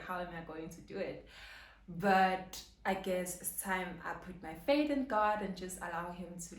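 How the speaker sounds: ordinary delivery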